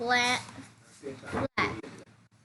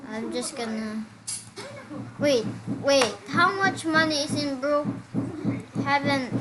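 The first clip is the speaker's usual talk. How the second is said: ordinary talk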